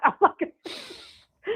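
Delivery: breaks into a high laugh mid-sentence. Real, not acted. Rescuer laughing as she corrects son to grandson. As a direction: laugh through the words, amused at herself.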